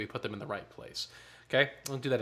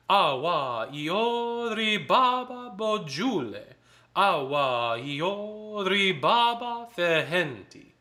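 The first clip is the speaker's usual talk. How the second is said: rhythmic chant, higher pitched; up tempo rhythm; 8 semitones higher, 6 dB louder, much slower than usual. Shown as a demonstration. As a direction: drive the syllables up tempo. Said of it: "up tempo this time".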